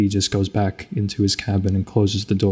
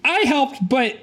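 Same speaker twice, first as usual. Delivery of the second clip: high squeaky little creature voice; 10 semitones higher than usual. Acted. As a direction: pitch way up, small and squeaky. Player voices a small creature.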